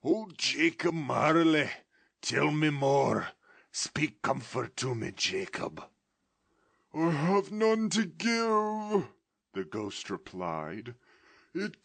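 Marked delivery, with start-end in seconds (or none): 0.0-5.9 pleading, louder then softening to a whisper; imploring. Acted. Scrooge imploring Jacob Marley to speak comfort. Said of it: "he said imploringly". 6.9-9.1 slow, high, loud mournful wail; ghost's voice. Acted. Ghost lamenting its fate to Scrooge in a slow wail.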